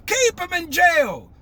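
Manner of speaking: high, slow retold command; imitating the president's order. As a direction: mimic a stern leader's verdict, drawn out. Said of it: "He said".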